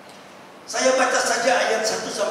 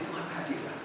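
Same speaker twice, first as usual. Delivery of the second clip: whispered prayer; 9 dB quieter than usual. Retold or imitated. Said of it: "Lama saya gitu".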